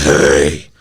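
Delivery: a low mock growl of a pissed-off dad; deep angry dad. Son doing his dad's low angry yell. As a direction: drop low like a grumpy father. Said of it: "this guy says".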